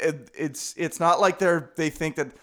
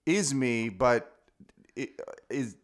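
slow, careful dictating to phone; slow dictation; 4 semitones lower, slower than usual. Acted. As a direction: spell it out for the phone. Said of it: "send that text right now".

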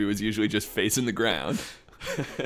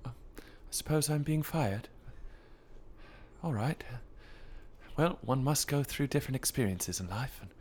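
soft, polite little bear taking the news gently; a quiet, well-mannered bear accepting his firing; Paddington impression; 10 dB quieter than usual. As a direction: play a mild British bear being fired, much softer. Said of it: "Do you wanna hear my Paddington".

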